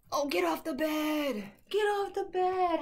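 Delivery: drawn-out, high Southern scolding; high Southern-accented dog talk. Real, not acted. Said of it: "Southern accent".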